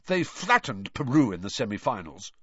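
ordinary delivery, nothing marked

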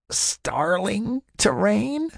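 slow, high mocking question; mock his slip slowly; mocking tease. A narrator's reading. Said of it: "she teased".

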